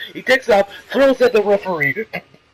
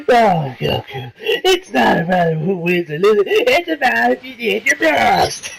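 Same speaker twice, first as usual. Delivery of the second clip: slow, put-on sage voice, lifting higher at the end; mock wise sage; 3 semitones higher, slower than usual. Reviewer voices the villain lecturing Goku, slow mock-sage voice. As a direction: preach sportsmanship like a hammed-up old master. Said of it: "So he's like".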